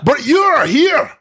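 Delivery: hammed-up rallying cry; rousing movie speech. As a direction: shout it back like a soldier's chant. Echoing the speech together.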